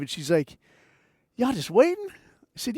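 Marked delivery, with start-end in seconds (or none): 1.4-2.1 high-pitched, lilting question; put-on woman's voice. Stranger at the van window, imitated woman's question.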